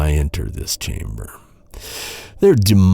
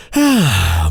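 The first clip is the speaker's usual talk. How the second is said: weary sigh; 5 semitones higher than usual. Acted. Bored knight sighing at silent prisoner.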